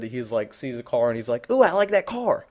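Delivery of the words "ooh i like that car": put-on high, loud little-kid blurt; excited three-year-old. Imitating a small child spotting a car, high excited mock squeal. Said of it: "like a three-year-old would".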